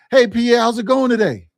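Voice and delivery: loud, bright, high sales hello; upbeat friendly greeting. Acted. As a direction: greet the customer like an old buddy. Acting out a friendly sales call.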